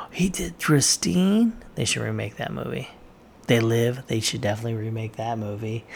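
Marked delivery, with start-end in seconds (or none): 0.1-1.6 higher, slow, drawn-out surprise; suddenly realizing he made it; surprised realization. Real, not acted. Excited vlogger realizes his favorite director made Christine, surprised.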